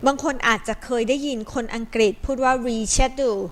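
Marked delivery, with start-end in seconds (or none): 2.7-3.4 reschedule with a British SH, "re-SHED-yool"; British English reschedule, no k sound; British pronunciation. Shown as a demonstration. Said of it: "ก็คือเป็นสำเนียงอังกฤษ".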